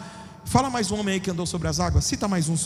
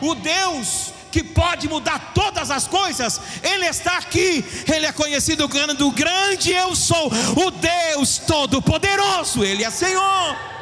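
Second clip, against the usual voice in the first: higher, louder, shouted preaching; preaching at full force; 5 semitones higher, 5 dB louder than usual. Acted. Preacher proclaiming God's power to the church, shouting.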